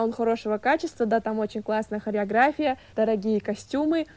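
normal talking voice

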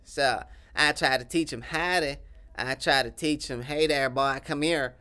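lightly voiced Texan accent, proud of his sauce; a hint of a boastful Texas cowboy; southern accent.

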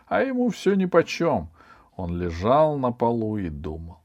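plain reading voice

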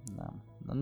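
ordinary talk, nothing marked

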